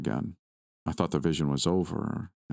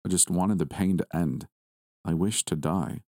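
ordinary delivery, nothing marked

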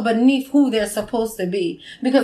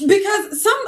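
ordinary talk, nothing marked

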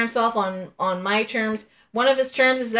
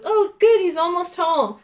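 imitating Linda; 9 semitones higher than usual. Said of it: "and she goes".

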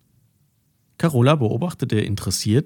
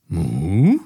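louder, questioning cow moo; puzzled moo; 2 semitones lower, 5 dB louder than usual. A narrator's reading. The cow Carola answers Mathilda, a baffled moo. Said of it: "schaute Mathilda verdutzt an".

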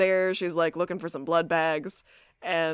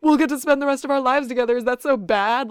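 higher, louder, rushed mock plea; mock pleading boyfriend; 6 semitones higher, 6 dB louder, faster than usual. Boyfriend pressing her about spending their lives together, mock pleading. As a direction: rattle it off loudly as an overeager boyfriend.

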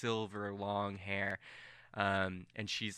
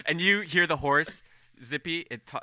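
ordinary talk

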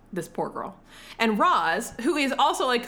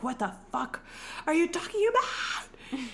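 high, softer incredulous question; mock disbelief; 8 semitones higher, 6 dB quieter than usual. Partner answering a covid warning, mock disbelief. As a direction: question it in a high, hushed squeal. Retells her reply in the fight.

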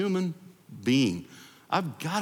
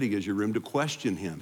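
usual speaking voice

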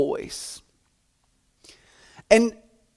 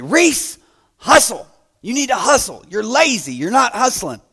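coach yelling; 8 semitones higher than usual. Retold or imitated. Coach yells at J J during practice, angry and high. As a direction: yell at the lazy kid to hustle. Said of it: "he would always yell at J J".

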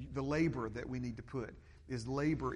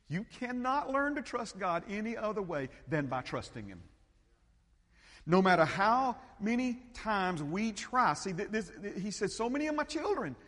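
preaching at full force; 9 semitones higher, 9 dB louder than usual. Acted. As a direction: build to a ringing pulpit climax. Preaching climax.

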